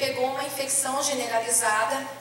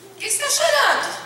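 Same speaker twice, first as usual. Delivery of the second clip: puzzled nurse; 12 semitones higher, 6 dB louder than usual. Retold or imitated. Nurse asks why she cries.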